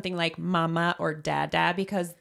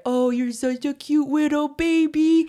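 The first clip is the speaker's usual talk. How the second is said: put-on high, slow, sing-song baby talk; 9 semitones higher, 5 dB louder than usual. Shown as a demonstration. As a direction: coo at a baby. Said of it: "motheries is what we call that baby talk".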